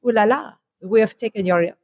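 put-on second go at a comic French accent; mock French accent. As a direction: play the French with an ooh-la-la accent. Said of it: "very wrong accent".

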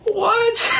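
very high-pitched "what"; re-enacted shock, voice leaping up; shocked disbelief. Fan recalling Dumbledore yelling at the kids, shocked disbelief.

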